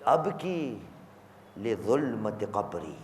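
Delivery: low, very soft quoted prayer; hushed mournful supplication; retold weeping lament. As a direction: recite the grief almost under the breath. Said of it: "मैं रो रहा हूं".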